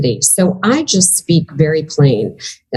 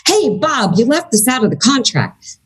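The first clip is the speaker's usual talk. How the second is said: pitched up, calling Bob out with an edge; charged accusing tone; 6 semitones higher than usual. Shown as a demonstration. Contract holder confronts Bob, charged accusing tone. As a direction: point the finger at Bob about the contract. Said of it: "I teach people to speak charge neutral".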